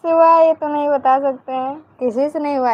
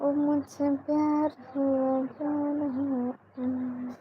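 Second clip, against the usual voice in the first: slow, soft, dreamy film-hero delivery of a love line; drawn out and softer, like a romantic movie scene; filmy dialogue; 9 dB quieter, much slower than usual. Pretend. Policewoman on a live chat reciting a romantic film dialogue, soft and slow. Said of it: "एक बार फिर से dialogue बोलो ना".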